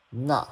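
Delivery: "拿" said as nà, short and falling. Shown as a demonstration. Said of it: "the logic is all the same".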